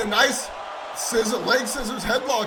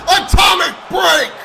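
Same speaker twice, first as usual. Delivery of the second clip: higher, louder move call; excited shout; 6 semitones higher, 7 dB louder than usual. Real, not acted. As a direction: call it out with hype. Commentator calls big move.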